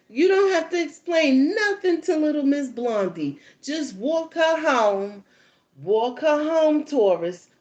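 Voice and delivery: loud, slow drawn-out bossy commands; bossy orders. Retold or imitated. Mom ordering the boy to walk the girl home, slow and bossy. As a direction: drag out every order.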